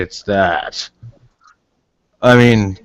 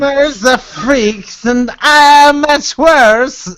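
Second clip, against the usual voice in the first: wretched gamer voice; 11 semitones higher than usual. Retold or imitated. Nick mimics a gamer's wretched lament for friends. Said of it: "I love that 'cause life is a pain".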